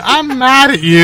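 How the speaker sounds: high, slow mock drunk whine; angry drunk. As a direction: ham up a cranky drunk. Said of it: "making you sound like an angry drunk".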